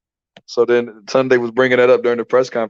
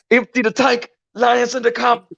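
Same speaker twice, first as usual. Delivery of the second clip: put-on much higher, accented trainer voice on request; imitating Tunde's accent; 10 semitones higher, slower than usual. Asked to redo the trainer's corner instructions. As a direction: repeat the trainer's line with his accent. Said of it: "What did Tunde say to Pat?".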